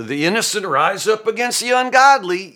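high, loud mock proclamation; ironic. Preacher voices Job ironically to his accusers, mock-grand. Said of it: "Job is being ironic there".

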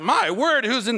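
high-pitched imitated gawking; mock astonishment. Onlookers see a big entourage pull up, mock astonishment. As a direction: exclaim like an amazed onlooker.

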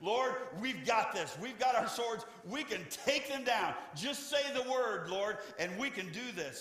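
fired-up men begging permission to fight; eager. Acted. Disciples urging a fight.

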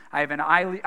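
ordinary talk

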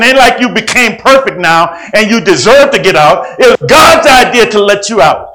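loud, high preaching voice. Acted. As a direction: preach it with fire. Preaching at full force.

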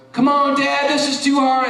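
put-on high, loud kid whining; whiny kid. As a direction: son whining that the work is too hard. Son whining again in retold scene.

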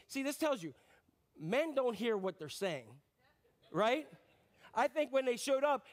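ordinary talk, nothing marked

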